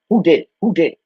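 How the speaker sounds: mock scolding. Real, not acted. Scolding dogs over a mess.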